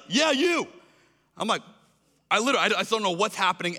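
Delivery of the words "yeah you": call back. Retold or imitated. Tim calls back.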